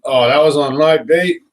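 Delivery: mock the angler with contempt; disdainful. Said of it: "It's like".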